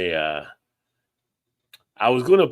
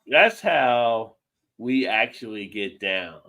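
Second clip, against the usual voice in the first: slow catchphrase; slower than usual. Real, not acted. Slowed catchphrase delivery.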